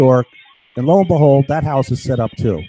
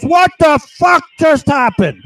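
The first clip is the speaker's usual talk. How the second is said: much higher, louder, slow startled voice; voicing a startled guy; 13 semitones higher, 6 dB louder, slower than usual. Man retelling voices he hears, high startled guy. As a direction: play the baffled intruder, high and drawn out. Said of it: "one of the guy goes".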